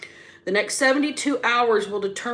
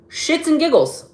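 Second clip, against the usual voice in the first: higher and louder, biting; angry shout; 7 semitones higher, 6 dB louder than usual. Shown as a demonstration. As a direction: bark it out like you're fed up. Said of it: "it's the volume or the inflection".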